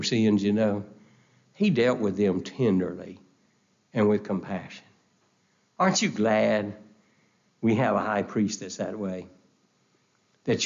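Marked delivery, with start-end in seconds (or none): none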